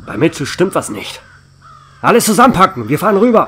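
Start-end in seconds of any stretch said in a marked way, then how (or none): 2.0-3.5 loud, higher barked command; rally the team at once; urgent order. Acted. (dringender, lauter Befehl)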